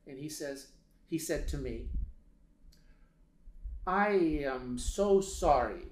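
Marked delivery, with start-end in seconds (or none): 3.9-5.9 put-on slow, solemn apology in a Lebanese accent. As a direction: imitate a somber man's Lebanese-accented English, drawn out. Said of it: "I'm not gonna do this the whole time".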